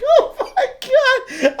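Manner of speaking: words squeezed out while cracking up; dying laughing. Real, not acted. Streamer retelling friends' ball talk, helpless laughter. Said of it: "I was dying laughing".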